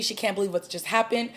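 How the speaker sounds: normal talking voice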